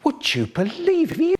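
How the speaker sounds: "Would you believe it?" pushed very high, rising on "it". Acted. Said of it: "Yes, again".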